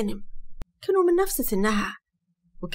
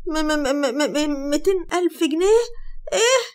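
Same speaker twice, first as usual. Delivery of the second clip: high stuttering disbelief rising to a squeal; flabbergasted, stuttered echo; shocked stammer; 6 semitones higher, 3 dB louder, slower than usual. A narrator's reading. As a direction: stumble over the figure, then pitch it way up. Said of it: "بصيت له بصدمة وقالت".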